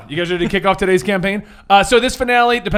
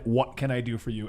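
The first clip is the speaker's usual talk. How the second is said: imposing World Forger; 8 semitones lower, 11 dB quieter than usual. Retold or imitated. GM voicing the World Forger to heroes: low soft looming voice. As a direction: speak down from a great height. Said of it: "looked down at you and said".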